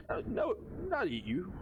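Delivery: high, simple monster voice; broken creature speech. Acted. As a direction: talk like a dim creature. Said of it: "gives you that look like".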